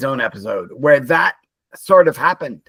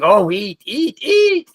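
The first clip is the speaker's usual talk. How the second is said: rising higher with each "eat"; mock eager urging; 5 semitones higher, 4 dB louder, much slower than usual. Aliens watching the family gorge, mock eager urging. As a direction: egg them on, pitch climbing.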